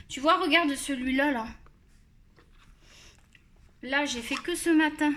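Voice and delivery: ordinary talk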